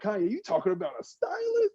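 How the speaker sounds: high, teasing disbelief; high-pitched mock scoffing; incredulous mock jab. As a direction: needle them like they said something absurd. Mock-incredulous retort, addressing as [name].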